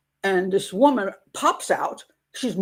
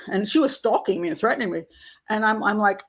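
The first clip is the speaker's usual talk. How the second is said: ordinary delivery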